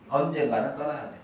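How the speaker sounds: ordinary talk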